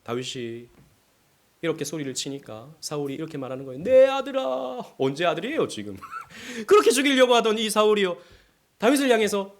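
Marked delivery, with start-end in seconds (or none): 3.8-4.9 Saul's tender call. Retold or imitated. Voicing Saul tenderly.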